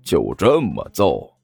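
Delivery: slow, low taunt; cold sneer. A narrator's reading. Guan Xiaoyu taunts after slapping Zhang Yefei, cold sneer. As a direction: say it slowly with cold amusement. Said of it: "冷笑着说道".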